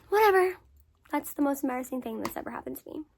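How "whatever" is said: much higher and louder whatever; exasperated whatever. Real, not acted. Brushing off the story to viewers, loud exasperated whatever. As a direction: toss it off louder, done with it. Repeated dismissal.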